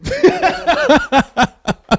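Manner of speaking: high, loud laugh; burst of laughter. Real, not acted. Laughing after the friend asks why he's looking. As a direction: caught out, laugh it off. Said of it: "Why are you looking at me".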